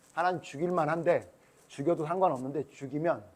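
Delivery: usual speaking voice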